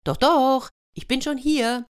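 small, high-pitched answering call; hedgehog calling back. Acted. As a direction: pipe up high from a hiding place. Hedgehog calls back from nest.

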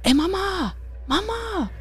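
high, drawn-out mock yell, held back in volume; high-pitched call down to the cellar; retold yelling. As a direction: imitate calling Mom through the house. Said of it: "meine Mutter durch das Haus geschrien".

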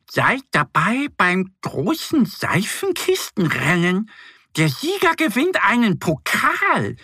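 lightly high duck voice reading out; a hint of a duckling announcing; little duck reading. A narrator's reading. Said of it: "liest Annie".

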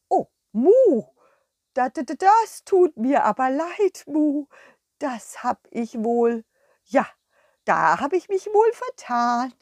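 the cow fumbling an apology; embarrassed cow. Acted. The cow Kurita apologising to the dog, flustered.

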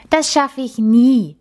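put-on a frustrated kid who gives up; angry grumble. Emma scolds her puzzle, angry and loud. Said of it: "schimpft sie".

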